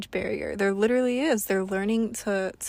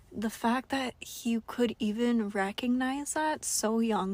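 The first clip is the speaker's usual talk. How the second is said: usual speaking voice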